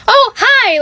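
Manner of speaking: high, gushing mock greeting; put-on cheery greeting. Caller greeting the postmaster she just asked for, put-on cheeriness.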